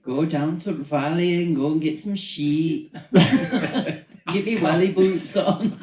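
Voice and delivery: put-on Welsh accent. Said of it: "do your best Welsh accent".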